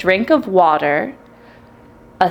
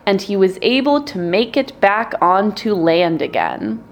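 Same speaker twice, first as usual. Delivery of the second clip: ordinary delivery, nothing marked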